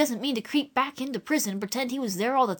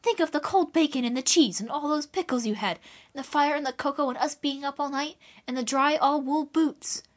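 persuading; 6 semitones higher than usual. Acted. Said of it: "in persuading tones".

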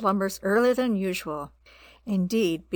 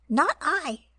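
high little rat voice; squeaky rat voice; 7 semitones higher than usual. Acted. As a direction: pitch it up like a rat. Rat refuses.

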